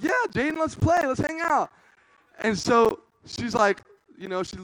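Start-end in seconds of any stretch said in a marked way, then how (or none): 0.0-1.7 high, loud eager invitation; fake eager. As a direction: oversell the fun. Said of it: "I was like".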